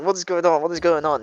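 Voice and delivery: high, loud alarmed questions; acted panic, repeating the question; panicking. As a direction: play a man flustered and alarmed. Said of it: "kinda panicking".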